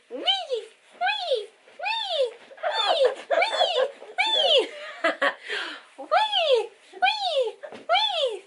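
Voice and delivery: very high playful "Wee!" calls; excited squeals. Real, not acted. Mom squealing to her baby at play.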